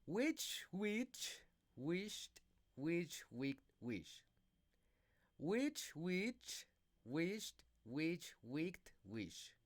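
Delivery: slow tongue twister. Shown as a demonstration. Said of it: "pronounce the tongue twisters".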